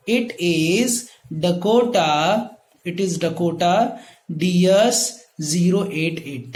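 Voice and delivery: "Dakota" drawn out slowly, word by word; slow dictation. Shown as a demonstration. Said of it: "teacher dictates sentence". (धीमी, रुक-रुक कर dictation)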